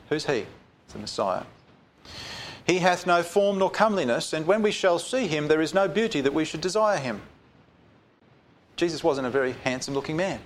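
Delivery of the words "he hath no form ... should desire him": raised, ringing Bible reading; solemn raised reading voice; scripture reading. Acted. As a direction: proclaim the verse to the congregation. Reads scripture aloud.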